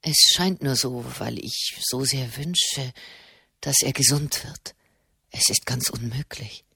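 pleading with himself in a low hush; the monk denies the miracle in a low, soft voice; distraught. A narrator's reading. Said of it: "dachte Marquesano verstört".

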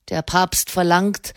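ordinary delivery, nothing marked

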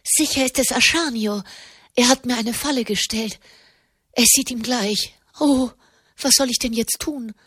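a lady caught in a trap, rising to panic; frightened lady. Acted. Frightened woman fears trap.

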